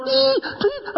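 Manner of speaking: put-on high kid's yell, bright and sudden; child's high-pitched call; grandkid's shout. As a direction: shout it like an excited grandchild at the table. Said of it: "I'm over there going".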